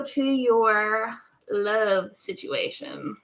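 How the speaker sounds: slow, drawn-out stretch on love; half-speed, savouring the phrase; drawn-out, playful. Real, not acted. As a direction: linger teasingly over each word. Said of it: "wanted to say it like that".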